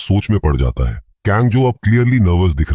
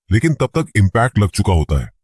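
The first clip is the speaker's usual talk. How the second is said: ordinary delivery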